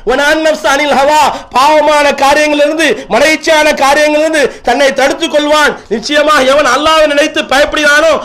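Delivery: sustained, ringing scriptural proclamation; forceful preaching. Real, not acted. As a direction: recite the verse and drive its meaning home. Recites and translates scripture forcefully.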